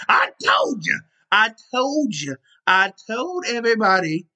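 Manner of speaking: high, sing-song I told ya; excited gloating. Real, not acted. Podcaster gloating to doubters that the Bucks won, excited sing-song. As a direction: crow it like you called it.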